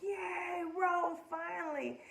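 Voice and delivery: high, delighted cheer, Rose drawn out. Retold or imitated. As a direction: celebrate like your team scored. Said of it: "And I was like".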